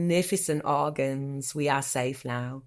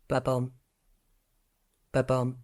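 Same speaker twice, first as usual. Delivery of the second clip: heartbeat sound; 3 semitones lower than usual. Pretend. Voicing a heartbeat in poem.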